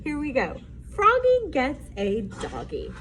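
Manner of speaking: usual speaking voice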